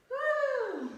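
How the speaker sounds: high, loud woo of joy; excited cheer. Real, not acted. Cheering kids in bunny pose.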